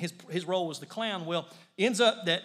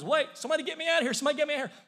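fast, high panicked cry for help; scared plea; 8 semitones higher, much faster than usual. Retold or imitated. Clown in the lion's den begging to be rescued in panic. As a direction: beg to be pulled out, quickly. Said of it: "Well, he's scared".

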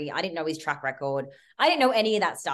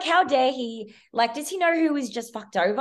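high, loud outrage; indignant rant; 7 semitones higher, 3 dB louder than usual. Retold or imitated. Venting to the producers on set, furious. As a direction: fume at the betrayal. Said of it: "I absolutely flipped it".

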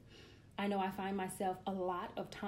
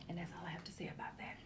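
fast unvoiced whisper; whispered sign-off; 4 semitones lower, 13 dB quieter, faster than usual. Real, not acted. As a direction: whisper the final word quickly.

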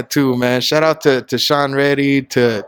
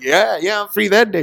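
higher-pitched eager yes; retold eager reply; 6 semitones higher than usual. Retelling his answer to being asked if he's free, mildly eager. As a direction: play back an eager acceptance lightly.

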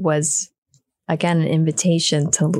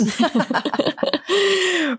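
high bright laugh; a high, delighted laugh; 11 semitones higher than usual. Real, not acted. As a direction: laugh out at the joke. Joke about the gopis.

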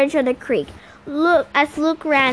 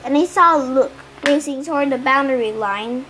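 ordinary delivery

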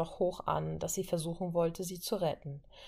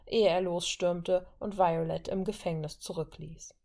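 ordinary delivery, nothing marked

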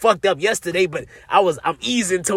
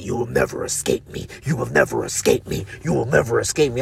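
much lower, hoarse whispered demon threat, easing toward normal; 5 semitones lower, 4 dB quieter than usual. Retold or imitated. Imitating the DMT demon taunting him, low menacing voice. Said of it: "He keeps saying it".

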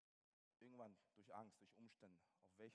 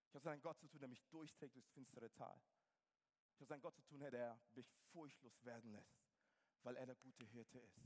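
ordinary talk, nothing marked

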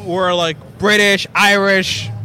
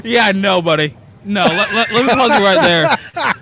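ordinary talk

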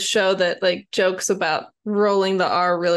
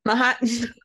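put-on Martin echoed in a Boston accent, "Maht-n"; mimicked Boston accent. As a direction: drop the R like a Bostonian. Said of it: "If you go to Boston".

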